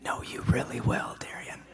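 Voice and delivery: soft breathy whisper in the ear; retold whisper, voiceless and intimate. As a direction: lean in and whisper it close. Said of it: "he whispers in my ear".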